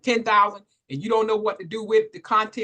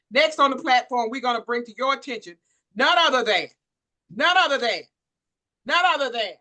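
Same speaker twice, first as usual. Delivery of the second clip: rising hype, repeating none other than with growing force; announcer build-up; 3 semitones higher, 3 dB louder than usual. Real, not acted. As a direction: build suspense like an MC. Host builds up introduction.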